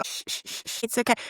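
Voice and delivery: soft shushing, breathy and hushed; imitating an adult shushing a kid. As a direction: hush them like a fussing child. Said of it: "be like".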